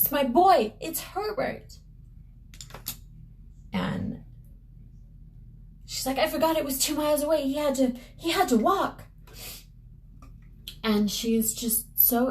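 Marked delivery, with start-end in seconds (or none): 0.0-1.8 overjoyed mother. Acted. Mother overjoyed at son's return. 6.2-9.0 high, rushed excited mother; excited realization. Acted. Mother excitedly explains son's delay.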